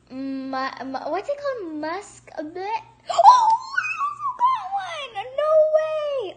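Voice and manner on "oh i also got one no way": high, loud squeal of joy; high, loud cry of disbelief; excited shriek. Real, not acted. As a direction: shriek with sudden delight. She also hatches one.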